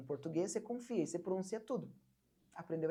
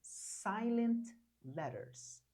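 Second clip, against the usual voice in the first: silent letters said very slowly; slow announcement; 3 semitones higher, much slower than usual. Shown as a demonstration. Teacher names the course pillar for students, very slow. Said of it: "O terceiro pilar do curso é chamado de".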